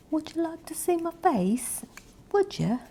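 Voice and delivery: put-on high, playful British accent. ASMR creator teasing viewers about seeing their face, British accent. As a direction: tease the listener in a high-pitched British voice. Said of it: "I break out into a British accent".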